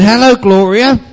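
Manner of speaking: high, loud bright sing-song hello; voice three greeting. A narrator's reading. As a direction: greet the new girl brightly. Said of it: "That must be voice number three".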